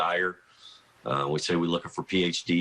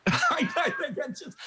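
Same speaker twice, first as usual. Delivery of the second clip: laughing. Real, not acted. Admitting he blew the recruitment.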